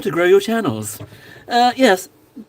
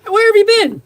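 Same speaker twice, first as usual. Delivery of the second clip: mock surprise; 4 dB louder than usual. Greeting a viewer with mock shock.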